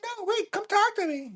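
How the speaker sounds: pleading in a very high falsetto; high put-on plea. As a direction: mimic a squeaky, sugary plea. High put-on voice in acted exchange.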